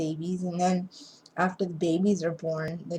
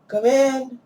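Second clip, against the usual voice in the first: raised, higher call to the door; 6 semitones higher, 9 dB louder than usual. Real, not acted. Vlogger calling someone in through the door, raised voice. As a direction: call someone in from across the room.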